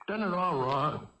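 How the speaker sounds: usual speaking voice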